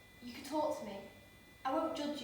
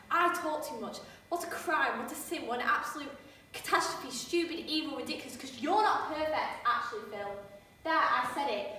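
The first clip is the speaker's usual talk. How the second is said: defensive rant; 3 semitones higher, 3 dB louder than usual. Acted. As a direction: throw the words back at him in a sarcastic tirade. [name]'s defensive rant at Philip.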